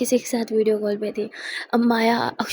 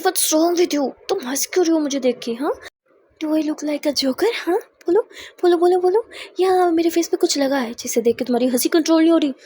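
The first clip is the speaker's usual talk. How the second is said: loud, higher, insistent bolo bolo; angry demand; 4 semitones higher, 4 dB louder than usual. Acted. Charu braking hard and demanding why Akshu laughs, loud. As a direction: demand an answer, building up.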